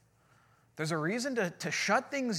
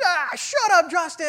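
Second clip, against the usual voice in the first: high, loud teasing heckle; mock heckle; 12 semitones higher, 11 dB louder than usual. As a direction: call it out like a buddy ribbing you from the front row. Friend heckles, laughing at joke.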